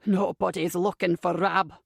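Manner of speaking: gruff Scottish smoker's voice; raspy Scottish accent. A narrator's reading. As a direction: dismiss them in raspy Scottish. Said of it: "the sickening voice".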